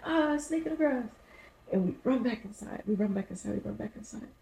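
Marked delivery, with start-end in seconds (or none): 0.0-1.1 higher startled "Ah" at the snake; mock startled cry. Walking the dog and seeing a snake, mock fright. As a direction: yelp like you just saw it.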